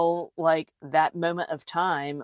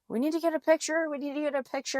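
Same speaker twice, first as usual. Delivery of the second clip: high, fast and eager; excited friend; 11 semitones higher, much faster than usual. Retold or imitated. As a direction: rush it out, thrilled. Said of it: "She's like".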